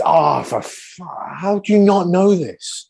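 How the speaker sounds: slow, rising disbelief; mock exasperation. Host mocks his co-host's slow exasperated disbelief. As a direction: exaggerate his impatience. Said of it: "I think it's".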